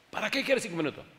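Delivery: high, doubtful question; puzzled dying man. Retold or imitated. Dying man questioning the preacher, lightly voiced.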